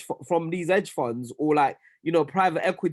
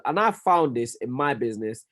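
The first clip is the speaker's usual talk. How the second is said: ordinary talk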